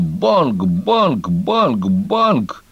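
ringing bangs, leaping high in pitch; storyteller's sung-out "bang, bang"; imitated gunshots. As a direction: mimic a volley of shots, banging out each one. Badger imitates gunshots in his tale.

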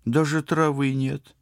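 high, slow, let-down complaint; mildly crestfallen girl; disappointed. A narrator's reading. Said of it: "разочарованно сказала Лика".

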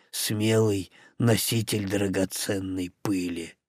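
deep and shy, kindly explaining; gentle orc voice. Acted. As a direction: orc offering a name, low and shy.

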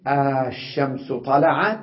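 "آه، الشمس طلعت" with a long "آه" at the start; drawn-out question. Shown as a demonstration. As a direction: stretch the opening "آه" into a long madda, then "الشمس طلعت". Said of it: "فتقول". (سؤال بمد طويل في "آه")

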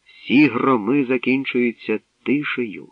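ordinary delivery

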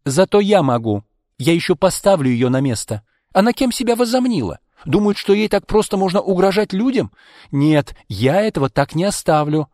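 acted fired-up indignation; indignant vow. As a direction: vow to put her in her place. Indignant friend vows retaliation.

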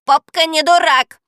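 high, loud parrot squawk; parrot's retort. A narrator's reading. Parrot answers the girl back, loud high retort. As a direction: shout back like an indignant parrot. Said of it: "ответил попугай".

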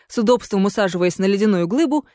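ordinary delivery, nothing marked

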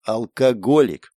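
slow, drawn-out mild disdain; scornful snort. A narrator's reading. Napalm to his friend pouring vodka, snorting scornfully. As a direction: snort the word slowly. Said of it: "фыркнул Напалм".

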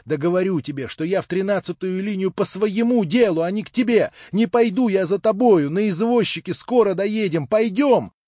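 loud, high, exasperated urging; impatient insistence. A narrator's reading. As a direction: build to a shouted command to go. Impatiently insisting, hurrying her off.

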